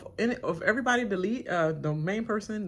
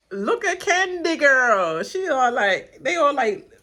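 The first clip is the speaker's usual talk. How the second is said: loud, high, slow excited call; 8 semitones higher, 11 dB louder, slower than usual. Real, not acted. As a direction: drag it out, thrilled. Excited recap.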